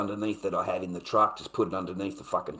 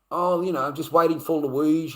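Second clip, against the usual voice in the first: imitated German accent, pitched higher; 5 semitones higher, 5 dB louder than usual. German guy explaining he is waiting for Louise, German accent. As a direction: do a light mocking German accent. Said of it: "You've got that German accent".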